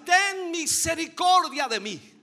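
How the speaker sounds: loud, high, slow plea; crying out for mercy. Retold or imitated. The woman crying to Jesus, loud pleading cry. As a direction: cry out to be heard from afar. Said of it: "Esta mujer gritaba y decía".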